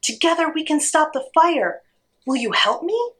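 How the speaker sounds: high, determined parrot call; mild storybook shout back. A narrator's reading. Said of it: "the parrot shouted back".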